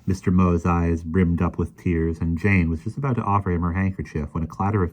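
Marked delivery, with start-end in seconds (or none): none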